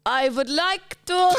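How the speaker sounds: slow, high South African shout; South African accent. Acted. Friend asks for South African, she shouts it slowly. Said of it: "South African".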